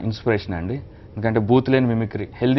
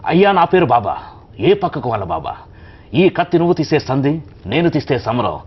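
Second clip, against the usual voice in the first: higher, louder punch-dialogue delivery; Rajinikanth dialogue; 4 semitones higher, 5 dB louder than usual. Acted. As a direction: hit each line like a mass hero. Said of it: "ఒక dialogue".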